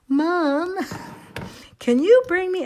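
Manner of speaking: little son asking. Retold or imitated. Son calling mom for a ride home, high pleading child voice. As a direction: play the small kid begging mom on the phone. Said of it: "the little one calls".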